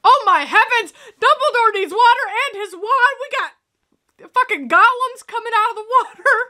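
high-pitched alarmed exclamation. Real, not acted. Viewer watching creatures rise from the water, alarmed.

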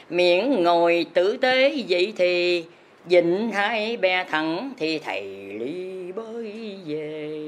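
ordinary talk

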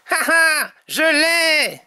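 high gloating character cry; triumphant Tom. A narrator's reading. Tom brandishes the carrot, high triumphant cry. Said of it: "S'écrie Tom ... d'un air triomphant".

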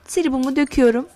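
ordinary delivery

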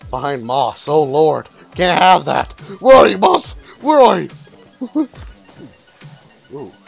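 ordinary talk, nothing marked

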